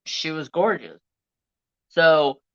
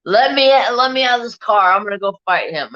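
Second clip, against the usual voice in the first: put-on drunk demand to fight; 6 semitones higher, 6 dB louder than usual. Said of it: "He's like".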